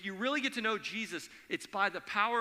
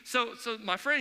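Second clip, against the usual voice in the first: ordinary delivery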